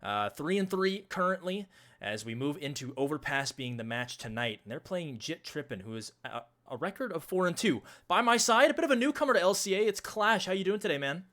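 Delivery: loud, high, driving broadcast opener; hype announcer intro. Real, not acted. Caster welcoming viewers to the match, loud hype announcer voice. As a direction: sell the show like an esports caster kicking off a match.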